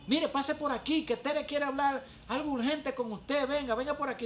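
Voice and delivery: high, loud woman on the phone; retold phone plea, pitched well up; imitating urgent caller. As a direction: act out a church sister summoning someone urgently. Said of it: "me dice".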